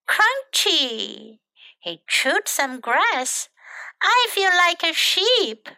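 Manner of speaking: lively, animated picture-book reading; children's story narration. A narrator's reading. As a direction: read it to small children with bouncy character lines.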